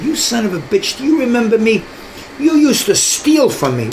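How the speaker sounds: put-on reliving a childhood grudge; angry retold accusation. Retold confrontation with the cop.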